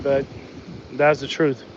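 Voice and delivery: ordinary delivery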